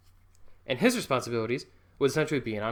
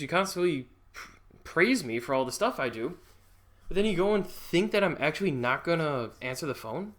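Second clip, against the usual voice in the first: ordinary delivery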